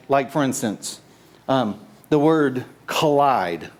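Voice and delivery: usual speaking voice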